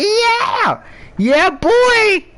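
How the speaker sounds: high whooping cheer; mock celebration. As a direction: gloat like throwing a party. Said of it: "I might throw a party".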